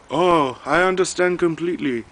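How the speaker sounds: high, loud imitated Filipino accent; heavy Filipino accent. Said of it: "very heavy Filipino accent".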